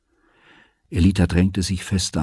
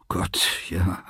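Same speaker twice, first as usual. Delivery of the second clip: müde; 6 dB quieter than usual. A narrator's reading. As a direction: let it out softly and wearily. Father's weary dismissal. (leise, müde abwinkend)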